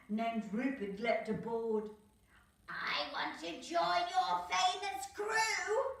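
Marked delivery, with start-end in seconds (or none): 2.7-6.0 high, louder, slow put-on devil voice; mock roar. Tasmanian devil asking to join the crew, hammed-up high roar. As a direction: ham up a Tassie devil roaring. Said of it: "he roared".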